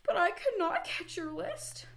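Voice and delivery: high, winded Frog voice; lightly acted breathlessness; gasping. A narrator's reading. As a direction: apologize high, as if spent from running. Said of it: "gasped Frog".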